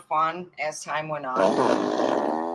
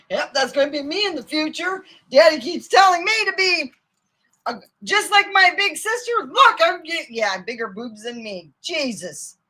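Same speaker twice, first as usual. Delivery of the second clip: high, pitched-up mock girl voice; mock girly brother; 10 semitones higher, 5 dB louder than usual. Imagined brother dreaming of being like his sister, mock girly voice. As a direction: imitate the brother as a giddy little girl.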